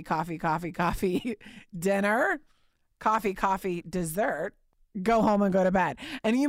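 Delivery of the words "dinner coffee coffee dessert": ordinary delivery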